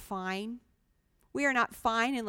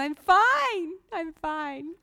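high, bright forced-cheer "fine"; fake cheerful fine; 7 semitones higher, 5 dB louder, much slower than usual. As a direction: chirp it out with a big fake smile. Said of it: "And you smile, the, your great big smile".

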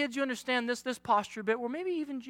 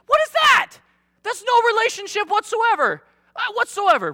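much higher, loud; mock outrage; 10 semitones higher, 4 dB louder than usual. Real, not acted. Preacher mocking the side hug, high disbelief. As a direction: squeal in disbelief.

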